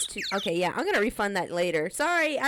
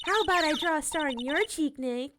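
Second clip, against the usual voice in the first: put-on higher, bubbly teenage girl voice, teasing; Maya character voice; 8 semitones higher than usual. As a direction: play the cheeky girl sidekick, pitched up. Streamer voicing Maya in game.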